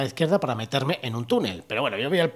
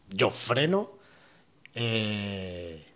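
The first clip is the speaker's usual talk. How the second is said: ordinary talk, nothing marked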